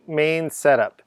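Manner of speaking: usual speaking voice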